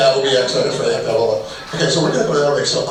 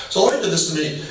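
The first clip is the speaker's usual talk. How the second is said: usual speaking voice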